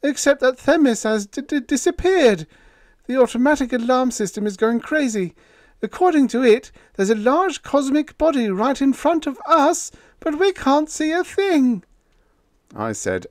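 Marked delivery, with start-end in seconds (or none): 0.0-11.8 put-on high, loud, panicky young voice; frightened report. A narrator's reading. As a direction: rush it out scared. Todd reports the vanished moon.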